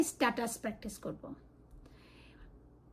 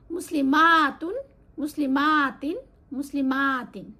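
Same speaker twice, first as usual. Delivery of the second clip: মুসলিমাতুন stretched slow and loud; drawn-out drill; 5 dB louder, slower than usual. Shown as a demonstration. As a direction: stretch each ending slowly for the class. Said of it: "যেমন feminine হচ্ছে". (ধীরে টেনে জোরে মুসলিমাতুন)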